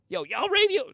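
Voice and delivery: high, loud mimicked yell; mock agitated outburst. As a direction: imitate him blurting it out, high and loud. Mimics an agitated radio guest.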